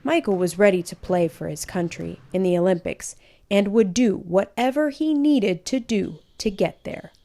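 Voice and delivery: ordinary delivery, nothing marked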